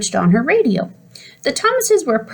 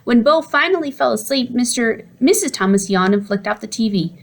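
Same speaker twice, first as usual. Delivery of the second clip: plain reading voice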